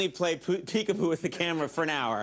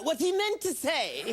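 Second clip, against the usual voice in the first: much higher, slow goofy gorilla voice; gorilla calling in; 11 semitones higher, slower than usual. Retold or imitated. Gorilla calls the host to smooth things over, goofy higher voice. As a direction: play a dim, helpful gorilla on the phone. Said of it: "Then the gorilla will call".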